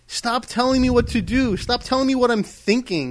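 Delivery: vent, fed up with being told what to do; exasperated. Real, not acted. Arguing with co-host, exasperated.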